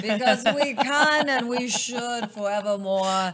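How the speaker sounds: slow, put-on English accent; drawn-out English accent, playful. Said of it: "don't be surprised if we talk this way".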